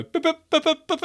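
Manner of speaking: high, clipped boop boop imitating a phone; very high beeping notification sounds; phone message beeps. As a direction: mimic a phone pinging with messages. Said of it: "I hear my phone just go".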